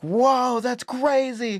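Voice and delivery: high, loud imitation of a gullible listener; mock amazement. Retelling how strangers swallowed the lies, mock-amazed. Retold listeners falling for the lie.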